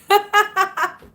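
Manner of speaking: loud high squealing laugh; shrieking laugh at a viewer's name; burst of laughter. Real, not acted. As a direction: lose it completely. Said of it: "I don't mean to laugh".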